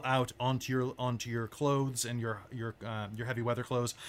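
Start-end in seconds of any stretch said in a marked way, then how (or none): none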